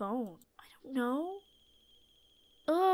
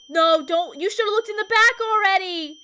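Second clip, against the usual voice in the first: high, loud, alarmed scolding; alarmed protest; 7 semitones higher, 5 dB louder than usual. Real, not acted. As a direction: wail a warning too late. Dreading what is in back.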